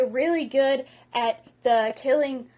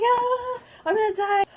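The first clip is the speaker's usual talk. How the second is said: high-pitched pretend panic; hammed-up wail of terror; mock freaking out; 11 semitones higher than usual. Astrid on the dragon flipping upside down, mock freaking out. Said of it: "freaking out".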